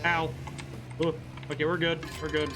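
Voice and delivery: usual speaking voice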